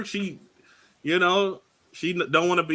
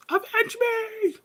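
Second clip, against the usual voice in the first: high, slow, strained dying plea; overacted death scene; 3 dB quieter, slower than usual. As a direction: ham up a dying wish. Acting out a dying character's plea.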